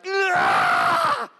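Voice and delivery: high, loud wordless yell of pain; mock pained cry. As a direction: cry out in pain instead of cursing. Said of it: "I'm just like".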